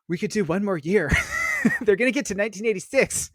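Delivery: giddy, half-laughing quote; eager. Retold or imitated. Movie fans egging each other on to stay up, eager.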